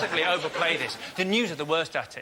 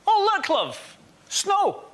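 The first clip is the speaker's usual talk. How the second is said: breezy high mock Scottish shrug; higher, light Scottish voice; 10 semitones higher than usual. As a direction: toss it off like an unbothered Scot. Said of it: "Scottish people do this".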